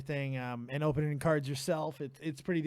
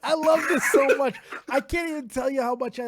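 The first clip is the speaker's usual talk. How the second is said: laughing, higher and louder; laughing delight; 8 semitones higher, 7 dB louder than usual. Real, not acted. Host laughs with delight at caller's funny voice. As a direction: burst out laughing with joy.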